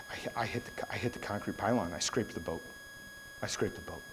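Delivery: hushed, low, halting admission; guilty stammered confession. Retold or imitated. Brother confessing the scraped boat to dad, guilty and hushed. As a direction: imitate owning up, voice sinking away.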